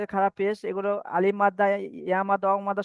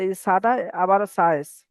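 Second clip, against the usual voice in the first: normal talking voice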